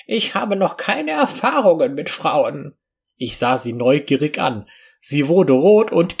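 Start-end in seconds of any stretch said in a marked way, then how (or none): 0.1-2.7 mildly hesitant, higher-pitched voice; a touch of shy hesitation, pitched up. A narrator's reading. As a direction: confess it a little hesitantly. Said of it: "sie zögerlich".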